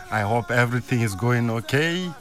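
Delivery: ordinary delivery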